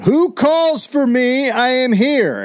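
high, slow grand proclamation; yelling. Acted. Benjamin stepping out to the shouting crowd, yelling. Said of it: "he yells, quote".

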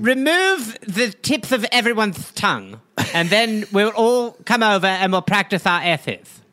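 "tips" and "S's" with th for s; thick comic lisp on "practice our S's"; heavy lisp. Acted. Lisping king ordering tongues cut, lisped "S's". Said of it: "must also have the lisp".

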